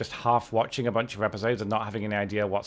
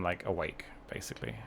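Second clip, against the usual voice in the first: whispered; 7 semitones lower, 13 dB quieter than usual. Real, not acted. Trails into a whisper.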